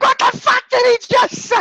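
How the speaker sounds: high, loud mock disbelief; hammed-up outrage at the screen; imagined outrage. Friend imagining his reaction to the movie, high mock outrage. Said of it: "I could just imagine your reaction".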